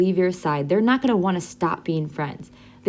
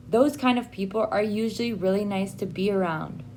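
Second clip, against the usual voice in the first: ordinary talk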